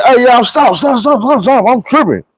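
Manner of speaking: hey, hold up, something's wrong with me; urgent calling out. Retold or imitated. Calling his friends to stop while he's tripping, high urgent call.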